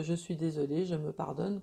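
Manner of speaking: quiet, gentle self-forgiveness; soft apology phrase. Shown as a demonstration. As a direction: murmur it softly to yourself. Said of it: "vous dites".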